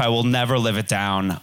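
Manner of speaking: ordinary talk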